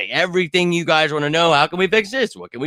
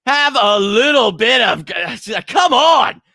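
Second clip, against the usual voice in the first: exasperated yell; 10 semitones higher, 6 dB louder, slower than usual. Real, not acted. Scolding fans, yelling.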